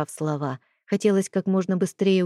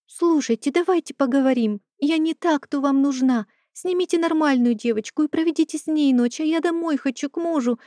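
put-on much higher, anxious girl's plea; frightened pleading; 8 semitones higher than usual. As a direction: beg nervously in a high voice.

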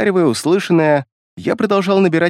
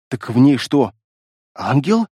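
a touch of awe in the question; with trepidation; 2 semitones lower than usual. A narrator's reading. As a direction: ask it hesitantly, mildly awestruck. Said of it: "С трепетом спросила волчица". (спросить с лёгким трепетом)

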